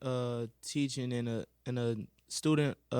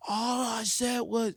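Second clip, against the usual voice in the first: upset student; 9 semitones higher, 5 dB louder, slower than usual. Retold or imitated. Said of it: "He was like".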